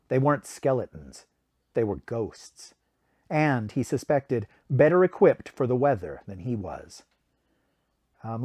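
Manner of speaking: usual speaking voice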